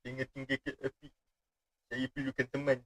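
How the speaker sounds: soft, hammed-up whimpering plea; mock plea. Streamer mock-begging viewers to stay, soft and pitiful. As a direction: beg softly like a lonely puppy.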